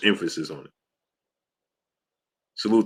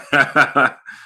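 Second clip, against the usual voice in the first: short burst of laughter; 7 semitones higher, 5 dB louder than usual. Real, not acted. Chat joking about starting war.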